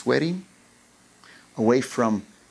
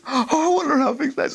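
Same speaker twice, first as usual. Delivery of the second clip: put-on eager boy; 11 semitones higher, faster than usual.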